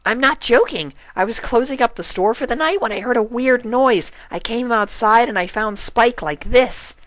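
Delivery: high, loud, eager Pinkie insisting; Pinkie earnest. A narrator's reading. Pinkie trying to convince Applejack, high and earnest. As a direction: sell it like she truly means it. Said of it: "trying her hardest to appear genuine".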